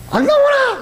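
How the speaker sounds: mock grumpy husband call; impatient old husband calling. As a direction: yell for her to hurry.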